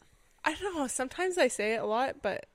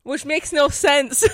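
giggling through the words; laughing; 6 semitones higher, 10 dB louder, slower than usual. Real, not acted. Cohosts crack up over Canadian slang, laughing. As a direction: laugh it out, high and loud.

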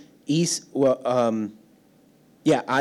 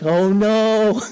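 high, louder mock panic; a dad's rising alarm as the baby cries; panicked oh no; 7 semitones higher, 5 dB louder than usual. Flustered dad panicking over a crying baby, mock alarm. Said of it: "and you're like".

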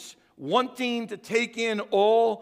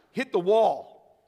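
slowed for effect; 3 dB louder, much slower than usual. Shown as a demonstration. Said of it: "You guys know what I'm talking about".

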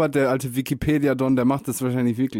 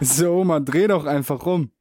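higher-pitched amused exasperation; mock exasperated; 3 semitones higher than usual. Grandchild telling Oma to swap her sounds, mock exasperated. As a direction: plead with her as if it were obvious.

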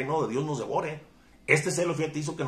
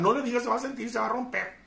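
mocking tone; 10 semitones higher than usual. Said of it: "mocking the soft-hearted".

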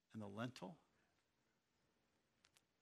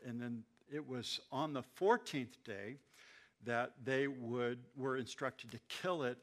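ordinary delivery

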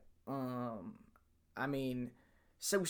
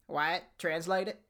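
ordinary talk

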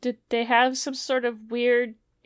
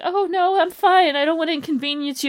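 high, brushing it off, polite and sheepish; polite wounded man; 8 semitones higher, 3 dB louder than usual. Pretend. Bleeding man insisting to friend he's fine, high and polite. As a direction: wave it away, too polite to fuss. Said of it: "I'd be, just be like".

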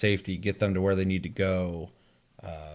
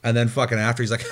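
normal talking voice

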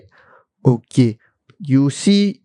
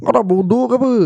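higher-pitched mock telling-off; Malay-speaking boss berates the assistant, a mock angry scold; 5 semitones higher than usual. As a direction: scold the dumb assistant, hammed up.

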